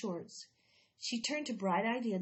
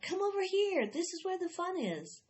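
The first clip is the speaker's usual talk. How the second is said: calling out; 10 semitones higher than usual. A narrator's reading. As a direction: shout the invitation to passersby. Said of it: "the lemonade stand shouted out".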